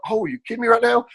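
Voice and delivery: high, incredulous; disbelief. Retold or imitated. Racer retelling how he reacted to the crash, incredulous. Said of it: "I'm like".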